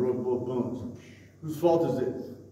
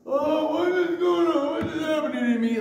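mock anguished lament; 6 dB louder than usual. Preacher acting out those in pain, mock anguished lament.

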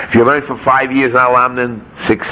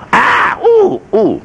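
high-pitched "ah, ooh" of pain; high mock moans; acted cry of pain; much slower than usual. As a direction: mimic a hurting patient's yelps. Acting out hospital patient in pain.

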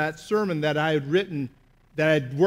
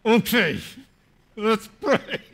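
1 through gritted teeth; 3 semitones higher, much slower than usual. Retold or imitated. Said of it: "through gritted teeth".

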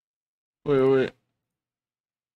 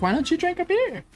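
imitating Mary; 11 semitones higher than usual. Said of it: "She don't talk like that".

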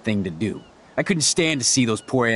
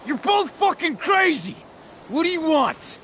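high-pitched, exasperated yell; hurt and enraged; pained fury; 14 semitones higher than usual. Acted. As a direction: demand answers through pain, voice way up. Wounded Frank yells at attackers.

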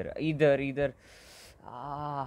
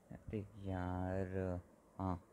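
ordinary talk

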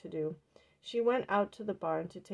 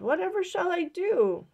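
much higher, loud despairing cry; distressed; 10 semitones higher, 8 dB louder than usual. A narrator's reading. Old woman despairing over the escaped ghost. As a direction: wail it in despair.

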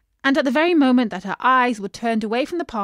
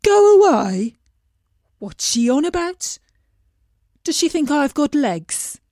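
higher, louder incredulous "Go away?"; baffled fish; 3 semitones higher, 5 dB louder than usual. A narrator's reading. Fish mocking the princess's odd request. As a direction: scoff at it in disbelief. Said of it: "the fish said".

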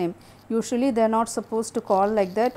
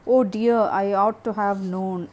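ordinary delivery

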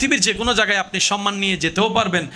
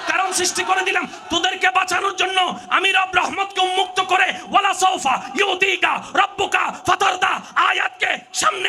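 full-force preaching; 11 semitones higher, slower than usual. Acted. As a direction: rouse the whole crowd at the top of your voice.

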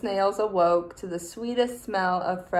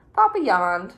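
usual speaking voice

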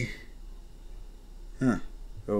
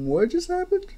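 confused. Acted. Goon confused by the chaos.

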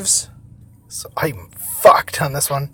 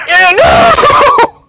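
very high, loud wail; dismayed shout; 6 dB louder than usual. Real, not acted. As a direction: howl in gamer dismay. Catch attempt fails in game.

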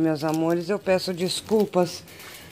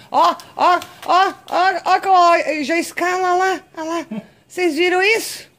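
much higher and louder, amazed; excited squeals; 9 semitones higher, 5 dB louder than usual. Real, not acted. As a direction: point and squeal at the climbing cat. Cat climbing, owner amazed.

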